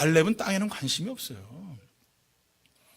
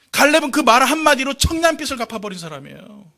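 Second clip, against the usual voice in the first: normal talking voice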